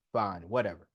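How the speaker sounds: low, soft, dismissive; resigned. Retold or imitated. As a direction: shrug it off. Giving up to the principal.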